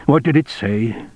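plain reading voice

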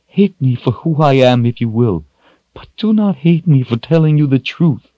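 high-pitched, emotional appeal; tearful plea. A narrator's reading. Shota defends telling Richard the truth, tearful plea. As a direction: plead earnestly through tears. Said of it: "tears in her own eyes".

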